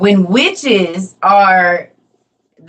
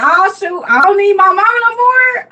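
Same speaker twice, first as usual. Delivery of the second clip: put-on high-pitched, excited voice speaking for a baby; excited baby grandson; 12 semitones higher, 4 dB louder than usual. Grandmother voices her grandson's thoughts on the walker, high and excited. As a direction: pitch it way up, thrilled and cocky. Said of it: "he was so excited".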